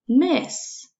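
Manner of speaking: "miss" lifted high and rising. Shown as a demonstration. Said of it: "Mm, eh, s".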